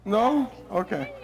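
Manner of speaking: ordinary talk, nothing marked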